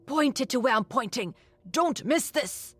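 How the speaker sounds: pressing order, rising and slowing on the last words; insistent, hushed-style command without whispering; unmistakable urgency. A narrator's reading. Professor directing the assistant's camera, urgent. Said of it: "the urgency in it was unmistakable".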